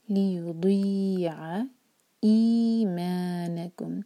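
very slow, ई and मा two counts; Quran recitation. Shown as a demonstration. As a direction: stretch ई and मा evenly. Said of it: "ई और मा दोनों दो दो हरका".

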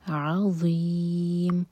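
عَظِيمٌ slow with a full-mouthed ظ, not ز; heavy dhaa. Shown as a demonstration. Teacher models the heavy ظ slowly. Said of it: "منہ بھر کر پڑھیں گے ضا".

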